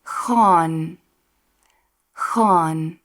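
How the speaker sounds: gaan with a harsh G from the back of the throat; Afrikaans G. Shown as a demonstration. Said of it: "practice a few words starting with".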